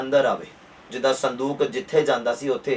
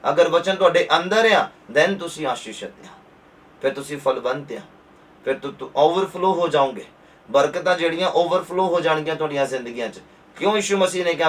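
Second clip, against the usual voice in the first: ordinary delivery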